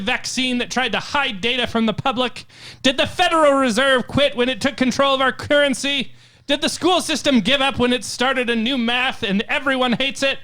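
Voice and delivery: loud, rising motivational coach speech. Pretend. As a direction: fire up the team like a halftime coach. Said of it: "my coach voice on here".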